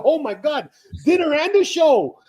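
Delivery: high, loud mock amazement; delighted exclamation. Churchgoer told he'll be fed, retold with high-pitched delight.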